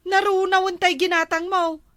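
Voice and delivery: loud, scolding wife; cross and loud; angry complaint. Acted. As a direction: throw the complaint out loudly. Wife quarrels over the melted halo-halo.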